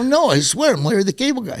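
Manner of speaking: high, fast protest; insistent. Retold or imitated. Comic insisting to a doubting stranger, high-pitched urgency. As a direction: plead to be believed.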